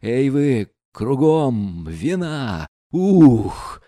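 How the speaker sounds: excited calling out. Acted. Рогожин в восторге зовёт всех.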